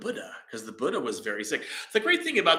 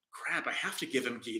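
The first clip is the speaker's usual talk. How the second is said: a softer muttered thought to himself; inner thought; 9 dB quieter than usual. Retold or imitated. Jivaka thinking to himself, softer and dismayed. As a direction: think it quietly, a little dismayed. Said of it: "And Jivaka is thinking, is like".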